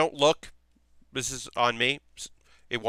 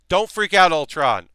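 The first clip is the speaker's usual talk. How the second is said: sudden loud, higher-pitched playful warning; mock alarmed warning; 6 semitones higher, 10 dB louder than usual. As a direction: call out in pretend alarm, dropping on the name. Mock warning to Ultron, then calms down.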